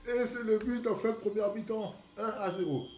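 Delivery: high, loud commentator recap; french sports commentary. Pretend. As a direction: announce the score like live TV. Mock commentator goal call.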